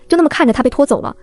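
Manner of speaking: ordinary delivery, nothing marked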